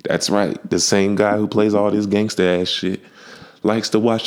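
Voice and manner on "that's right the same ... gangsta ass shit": ordinary talk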